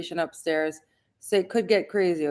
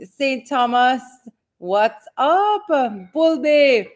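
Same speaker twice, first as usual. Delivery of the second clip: higher and louder call-outs; calling out viewers' hometowns, higher and louder; excited shout-outs; 6 semitones higher, 7 dB louder, much slower than usual. Real, not acted. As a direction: hype up each place name.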